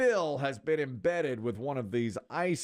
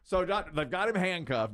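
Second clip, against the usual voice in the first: ordinary delivery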